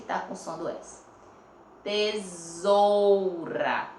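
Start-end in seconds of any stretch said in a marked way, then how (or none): 1.8-3.9 "Tesoura" very slow with a long buzzing Z; Z sound shown. Shown as a demonstration. Teacher asking pupils to hear the Z, drawn out. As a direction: stretch "Tesoura" syllable by syllable. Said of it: "Tá com som do Z".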